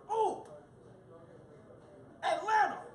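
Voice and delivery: high, loud eager guess; excited shout. Retold or imitated. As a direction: shout the answer proudly. Cousin shouts wrong answer.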